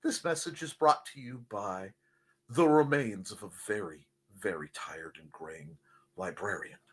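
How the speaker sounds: low, fading, worn-out announcement; weary sign-off. Pretend. Tired librarian signs off her rant, weary and low.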